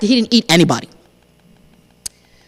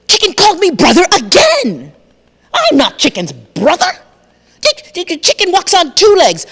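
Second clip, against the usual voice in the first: much higher and louder, indignant; indignant crocodile; 7 semitones higher, 4 dB louder, slower than usual. Acted. Crocodile insists he is not Chicken's family, indignant. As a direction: protest loudly that you're not related.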